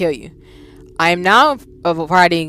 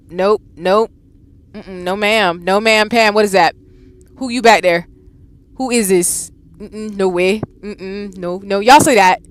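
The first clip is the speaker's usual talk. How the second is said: normal talking voice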